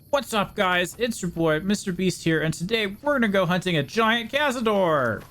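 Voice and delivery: hyped-up YouTuber intro, high and bright; clickbait video narrator. Pretend. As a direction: pitch it up like a MrBeast intro. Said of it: "Should I do typical YouTuber voice".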